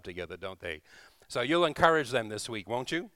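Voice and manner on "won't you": usual speaking voice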